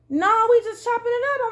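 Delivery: high-pitched retold protest. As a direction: object in a high, pleading voice.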